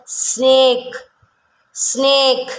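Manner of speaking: ஸ்னேக் drawn out from a long opening s; careful model ஸ்னேக் with a held vowel; pronunciation demo. Shown as a demonstration. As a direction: stretch ஸ்னேக் for learners to copy. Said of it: "என்ன சொல்லலாம் pronunciation".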